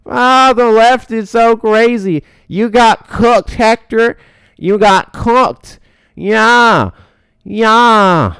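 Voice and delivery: high, loud, drawn-out mocking whine; whiny Liam impression. Host mocking a rival's voice to viewers, loud high whine. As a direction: whine it slowly at full volume, mocking him. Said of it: "That's how Liam sounds".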